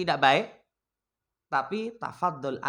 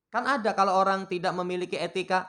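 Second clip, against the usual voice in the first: usual speaking voice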